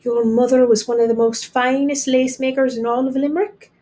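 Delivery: remember her with longing; hushed, wistful voice; wistfully. A narrator's reading. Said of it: "father said wistfully".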